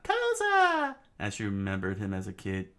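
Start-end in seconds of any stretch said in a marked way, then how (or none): 0.0-1.0 high, loud anguished scream; scream of name. Acted. Princess screaming as her friend falls. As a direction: shriek his name. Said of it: "Phoebe screamed out".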